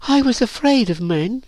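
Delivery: put-on high, girlish, downcast admission; shy confession. A narrator's reading. Virginia confesses with her head down, shy. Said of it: "her head down".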